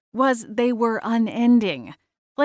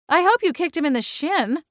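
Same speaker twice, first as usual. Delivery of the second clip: exclaiming; 7 semitones higher than usual. Acted. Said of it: "Gwen exclaimed".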